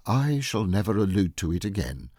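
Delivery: plain reading voice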